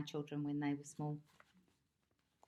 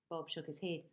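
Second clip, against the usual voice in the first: ordinary delivery, nothing marked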